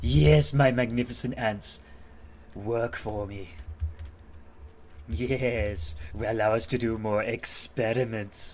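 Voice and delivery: loud, grandiose villain boasting to his ants; cackling lab genius gloating over creations; mad scientist voice. Acted. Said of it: "I tried to sound like the mad scientist on here".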